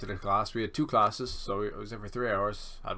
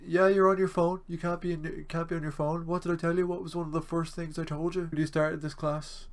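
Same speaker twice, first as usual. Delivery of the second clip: high, loud mocking teacher voice; exaggerated lecturer impression; 8 semitones higher, 4 dB louder than usual. Lecturer scolding a student for using his phone, in a high mocking voice. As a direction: ham up a scolding lecturer like he's talking to kids. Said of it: "He doesn't speak like that at all".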